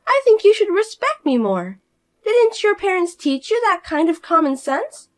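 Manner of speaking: high, loud, self-important outrage; indignant sister-in-law. Acted. Fiona demanding her sister-in-law respect her, high and indignant. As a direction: demand respect she hasn't earned.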